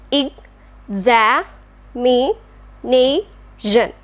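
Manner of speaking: Ex-a-mi-nation drawn out very slowly; syllable by syllable. Shown as a demonstration. Teacher slowly splitting examination into syllables. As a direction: pull "examination" apart into slow separate syllables. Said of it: "Next one is".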